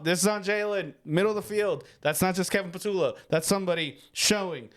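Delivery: ordinary talk, nothing marked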